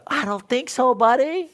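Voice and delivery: high, loud mock-defiant refusal; put-on voice, smug and dismissive; defiant inner voice. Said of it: "I'm thinking to myself".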